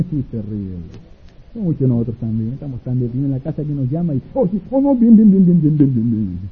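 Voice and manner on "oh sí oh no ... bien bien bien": chatty agreeing. Acted. Chatty phone call, repeated bien.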